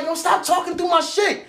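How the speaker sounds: real irritation shouted over the music; angry shout. Retold or imitated. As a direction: snap at him to stop cutting in. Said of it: "Now I'm getting mad".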